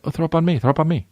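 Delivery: high doting parent voice; coaxing parent. Pretend. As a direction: sweet-talk a queasy kid. Future dad coaxing queasy kid.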